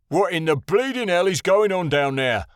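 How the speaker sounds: yelling. A narrator's reading. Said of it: "one of the Ortuzans yelled".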